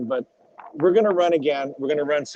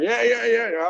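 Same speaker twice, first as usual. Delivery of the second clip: high-pitched, over-eager string of yeahs; mock nervous; 7 semitones higher than usual. Driver scared before the race, mock nervous eagerness. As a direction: imitate a jittery yes-man. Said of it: "I'm shitting bricks back there".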